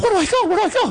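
high falsetto, frantic repeated plea; squeaky mock panic. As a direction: ask helplessly like a lost kid. Lost boy begs coach for help.

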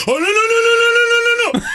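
a frantic denial, voice shooting up; panicked uncle. Retold or imitated.

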